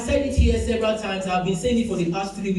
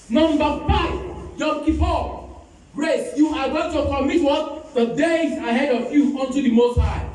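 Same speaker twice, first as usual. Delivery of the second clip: raised, high exhortation to the congregation; fervent preaching; 7 semitones higher than usual. Acted. Pastor exhorting the church to pray and fast, fervent preaching. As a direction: preach it out, pushing the pitch up high.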